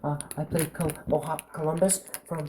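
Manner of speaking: hushed, hesitant actor impression; quiet mock celebrity murmur; Jesse Eisenberg. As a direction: recall your roles very softly, in character. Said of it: "you gotta whisper".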